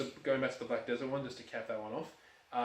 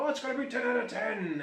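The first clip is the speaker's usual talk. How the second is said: high, excited gushing quote; gushing fanboy; 10 semitones higher, 3 dB louder than usual. Retold or imitated. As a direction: gush like a blind fan. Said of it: "like, go".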